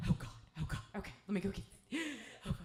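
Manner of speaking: fast, flustered mom with a gasp; imitating a frantic mom, hurried and gasping; mock panicking. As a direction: rush it like a panicked mom, breathless. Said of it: "my mom is, like, panicking".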